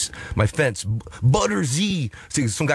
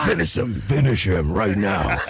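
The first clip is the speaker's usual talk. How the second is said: Mortal Kombat announcer; 5 semitones lower than usual. Retold or imitated. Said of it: "Mortal Kombat fight".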